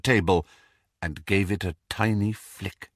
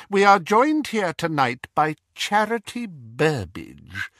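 high, cold, mocking villain voice; cold dark lord; 8 semitones higher than usual. Dark lord mocking the Death Eaters, cold and sneering. Voldemort introduces prisoner.

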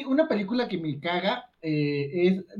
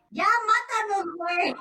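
mock plea; 10 semitones higher, 3 dB quieter, faster than usual. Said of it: "le diría".

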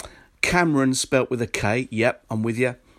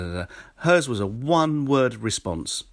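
usual speaking voice

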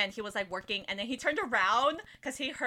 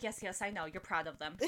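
low, soft soothing voice; quiet, patient reassurance; calming boyfriend; 5 semitones lower, 10 dB quieter than usual. Retold or imitated. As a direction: placate a crying partner gently. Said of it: "He had to come and calm me down".

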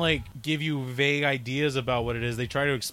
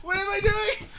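mock panic; 4 dB louder, slower than usual. Imagines himself lost at console.

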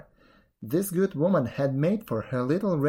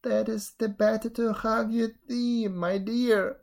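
raised voice of a wolf playing granny; disguised wolf; 6 semitones higher than usual. A narrator's reading. Wolf as grandmother reassuring girl, raised false voice. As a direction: answer high and evenly, posing as grandmother.

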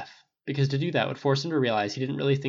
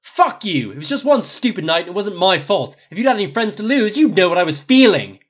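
higher, heated outburst; defensive shout, building louder to the end; 8 semitones higher, 12 dB louder than usual. A narrator's reading. Harry defending himself to Snape, shouting. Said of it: "Harry shouted".

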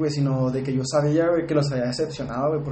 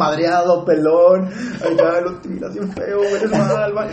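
louder, urgent pleading to calm her; pleading son; 3 semitones higher, 4 dB louder, slower than usual. Retold or imitated. Son calming his panicked mother, louder and urgent. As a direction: beg her to calm down, a bit loud.

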